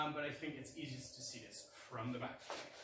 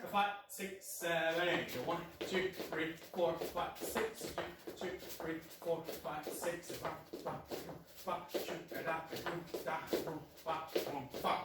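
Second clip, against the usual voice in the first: faster count. Shown as a demonstration. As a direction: pick up the tempo as you count. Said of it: "A little bit faster".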